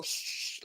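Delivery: long mock hush; shushing. Pastor hushing her after asking a question, shushing. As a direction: imitate shutting down an answer with a shush. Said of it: "and then go".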